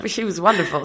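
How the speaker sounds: ordinary delivery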